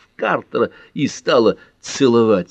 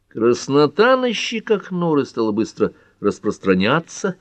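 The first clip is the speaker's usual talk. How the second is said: plain reading voice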